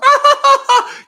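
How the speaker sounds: high cackling mock laugh; imitating Kamala's laugh. Host mocks a candidate's laugh with a loud high cackle. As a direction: throw out a big shrill mocking cackle. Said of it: "that thing she does".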